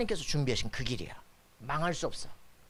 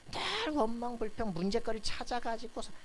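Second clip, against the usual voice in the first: mock grumbling; 8 semitones higher, 3 dB quieter than usual. Preacher mimics people always hunting for problems, mock complaining. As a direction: caricature someone forever finding fault.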